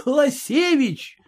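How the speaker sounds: high, slow exclaim through a laugh; Класевич! cried high and drawn out, laughing. A narrator's reading. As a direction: burst out at the name, amused. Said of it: "захохотал я".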